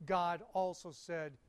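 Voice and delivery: ordinary delivery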